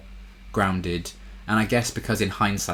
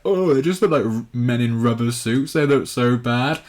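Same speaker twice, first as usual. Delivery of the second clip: whiny complaining; 5 semitones higher, 7 dB louder than usual. Retold or imitated. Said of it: "people were complaining, being like".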